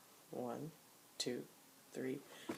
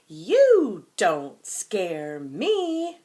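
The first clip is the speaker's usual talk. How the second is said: much louder, slow, higher defiant shout; 3 semitones higher, 14 dB louder, much slower than usual. Shown as a demonstration. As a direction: shout it out so the monster hears. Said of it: "We have to say it lound".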